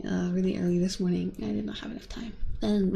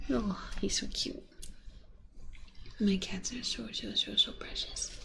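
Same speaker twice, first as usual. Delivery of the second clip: whispered cooing; 5 dB quieter than usual. Real, not acted. Whispering about cats.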